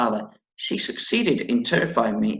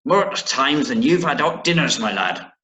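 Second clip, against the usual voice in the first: louder, rolling pirate accent on the boast; 2 semitones higher, 4 dB louder than usual. Shown as a demonstration. The old mariner boasting to the boy, in a pirate accent. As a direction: growl it like an old pirate sailor. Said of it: "in an accent like a pirate".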